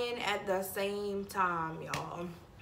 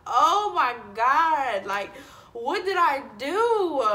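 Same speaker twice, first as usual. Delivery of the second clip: slow, louder panicked oh my God; 4 semitones higher, 7 dB louder, slower than usual. Retold or imitated. Reliving her panic over bills for the viewers, slow and loud. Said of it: "I'm like".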